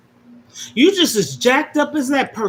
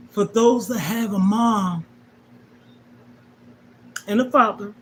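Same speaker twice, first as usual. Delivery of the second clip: ordinary delivery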